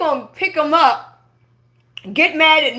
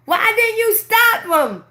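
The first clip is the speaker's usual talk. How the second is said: mother's accusing question; 7 semitones higher, slower than usual. Retold or imitated. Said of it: "She come running in there and say".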